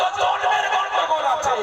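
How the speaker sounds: high, forceful declaration; commanding divine voice. Acted. (উঁচু গলায় জোরালো ঘোষণা)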